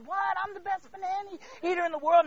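high, loud, comically indignant protest; mock panicked disbelief. Blindfolded contestant told he is losing, mock panic. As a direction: squawk it in hammed-up alarm.

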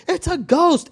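high-pitched cry of alarm; startled high exclamation, half-acted; mock scared scream. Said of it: "and began to scream because they were afraid".

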